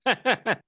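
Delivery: bursts out laughing; short real laugh. Real, not acted. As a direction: laugh at the joke. Laughs at the teasing.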